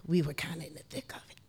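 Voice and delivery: hushed confiding whisper. Real, not acted. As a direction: drop to a secret whisper. Whispered aside about danger.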